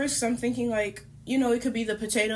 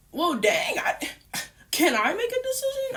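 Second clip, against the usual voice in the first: much higher, exasperated well dang; indignant squeak; 10 semitones higher than usual. Retold or imitated. Protesting to her boyfriend who decided alone, high indignant.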